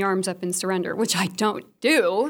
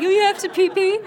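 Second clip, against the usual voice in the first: mock baby talk; 10 semitones higher, 6 dB louder than usual. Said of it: "I'm like".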